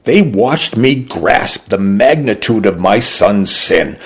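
ordinary delivery

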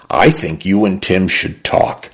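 ordinary delivery

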